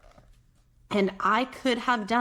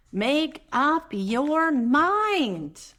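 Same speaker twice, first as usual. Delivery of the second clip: slow urging; 6 semitones higher, 3 dB louder, much slower than usual. Acted. Drawn-out urging.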